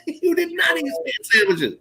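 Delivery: high, indignant denial. Retold or imitated. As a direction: protest that he never did. Arguing, high far voice.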